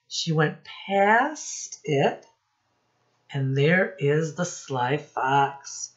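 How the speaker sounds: usual speaking voice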